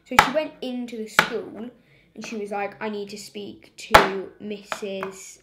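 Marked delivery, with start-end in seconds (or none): 2.8-5.4 mock angry demand. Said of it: "She was really mad".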